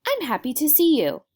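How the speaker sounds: Hey jumping way up high and loud; excited interjection. Shown as a demonstration. Teacher acting out an excited hello, high and loud. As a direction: burst out Hey like you're thrilled. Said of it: "you might say".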